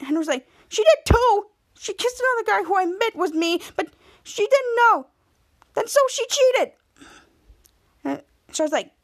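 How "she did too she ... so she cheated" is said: high-pitched acted protest; whiny arguing. Henry argues with a friend that Bianca cheated, protesting. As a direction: insist indignantly, voice pushed up high.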